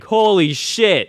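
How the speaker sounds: slow, high, amazed "holy shit"; amazed kid. Retold or imitated. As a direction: draw it out in childlike awe. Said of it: "you're like".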